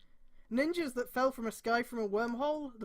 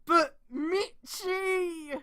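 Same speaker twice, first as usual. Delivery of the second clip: dramatic dismay; 7 semitones higher, much slower than usual. Real, not acted. Mock dismay over pairing.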